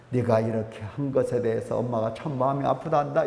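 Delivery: gentle motherly rebuke; tender mother. Retold or imitated. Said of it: "이러면".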